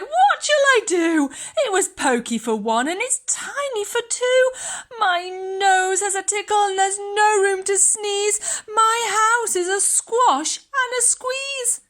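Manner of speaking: put-on high, wailing old lady, a touch tearful. A narrator's reading. Old lady despairing over her crowded house, high wail. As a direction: cry out like a flustered old lady. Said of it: "The little old lady cried".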